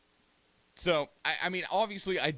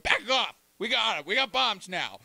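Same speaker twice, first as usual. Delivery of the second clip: higher, louder smug gloat; mock North Korea boast; 7 semitones higher, 5 dB louder than usual. Streamer voicing North Korea warning America, higher smug gloat. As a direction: puff up and warn them off.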